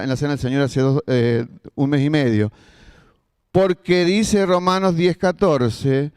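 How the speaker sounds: usual speaking voice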